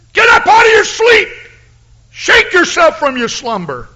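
high, loud, shouted sermon climax, rising with each cry; preaching at full force. Acted. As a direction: rouse the sleeping church with a booming, fiery call.

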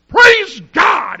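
big, high shout of praise; joyful shout. Retold or imitated. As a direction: belt it out like a revival cry. Preacher retells his excited cry.

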